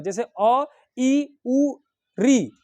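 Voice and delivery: अ, ई, उ, री each clipped short; short vowels. Shown as a demonstration. Teacher demonstrating short vowels, each said quickly. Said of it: "बहुत ही कम समय लग रहा है".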